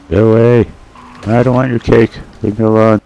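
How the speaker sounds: exasperated, high-pitched protest; annoyed shooing. Real, not acted. Fending off attackers in game.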